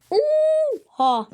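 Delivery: high shocked gasp of a word; amazed oha. Real, not acted. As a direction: blurt it out in shock. Amazed at loot count.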